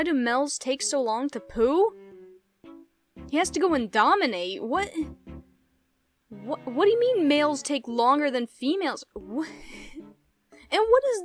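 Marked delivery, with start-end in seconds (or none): none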